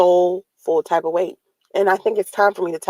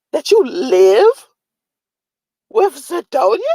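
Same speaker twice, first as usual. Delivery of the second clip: slow, loud, high disbelief; outraged disbelief; 4 semitones higher, 6 dB louder, slower than usual. Retold or imitated. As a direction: stretch it out in shock. Retelling her outrage at him.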